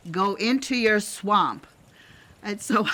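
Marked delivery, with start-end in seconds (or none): 0.1-1.7 slow, louder coaching command; a voice teacher telling the class to drop into the belly; voice teacher's cue. Retold or imitated. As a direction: boom it out like a drama voice coach. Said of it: "he used to refer to the belly as the swamp".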